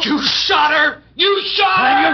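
high, slow, loud shouting; horrified shout. Acted. Husband shouting at the killer who shot his wife. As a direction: yell it in horror, drawn out.

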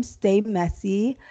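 ordinary delivery

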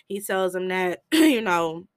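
usual speaking voice

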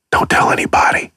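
quick, secretive whisper; confiding whisper. Pretend. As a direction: whisper it like letting someone in on a secret. Confiding a guilty pleasure.

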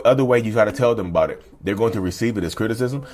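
normal talking voice

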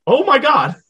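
high, loud shocked cry; real stunned outburst. Real, not acted. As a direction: gasp it out in disbelief. Shocked reaction to the scene.

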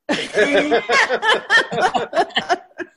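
big, high burst of real laughter; loud laugh. Real, not acted. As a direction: laugh hard at his own punchline. Laughs after his own joke.

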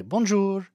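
"bonjour" high and lilting; native-style bonjour. Shown as a demonstration. Showing how you're supposed to say it, "bonjour" pitched high. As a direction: sing out "bonjour" as the French do. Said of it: "how you're supposed to say".

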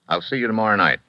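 ordinary delivery

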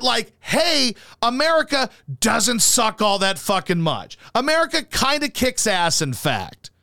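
ordinary talk

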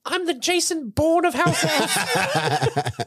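high, slow, swaggering elf squeak; cocky Dobby. Retold or imitated. Dobby bragging to Harry, slow high squeak. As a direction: drag it out like a proud action-hero elf.

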